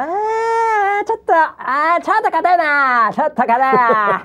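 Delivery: a hint of a father fretting that his son looks stiff; imitating the father in the corner. Father in the corner worrying over his boxer son, mildly imitated. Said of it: "みたいな感じなんですよ".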